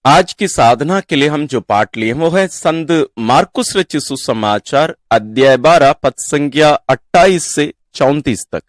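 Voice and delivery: ordinary talk, nothing marked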